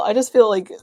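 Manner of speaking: normal talking voice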